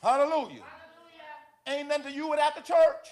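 high, loud preaching shout; full-force preaching. Real, not acted. Preacher exhorting brothers and sisters about the church, shouting fervently. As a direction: preach it at the top of your voice, pitch held high.

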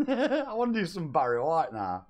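high, loud words through laughter; laughing. Real, not acted. Streamer tickled by his own impression, laughing as he talks. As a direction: giggle while drawing the words out.

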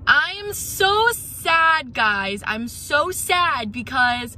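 loud, drawn-out lament; emotional yelling. Real, not acted. Venting to viewers about her closed outlet, loud and emotional. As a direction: wail it to the camera, overwrought. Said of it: "I'm fucking yelling because I'm so emotional".

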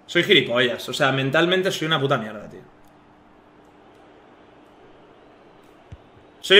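soft, low self-reproach; crushed after losing; dejected. Real, not acted. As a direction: mutter it in defeat. Self-loathing after loss.

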